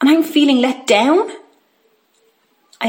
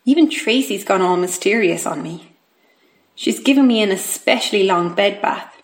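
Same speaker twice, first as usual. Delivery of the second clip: ordinary delivery, nothing marked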